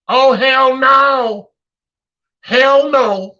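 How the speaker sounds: high, loud, drawn-out mock outrage on hell no; loud refusal. Comedian acting a lender refusing to forgive a debt, loud and high. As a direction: stretch out a loud mocking refusal.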